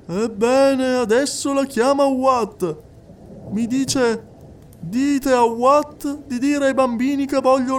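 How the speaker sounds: high, insistent clairvoyant addressing the hall; a medium coaxing a silent widow; medium's voice. Acted. Medium speaking to the crowded séance hall, high and insistent. Medium relays wife's message.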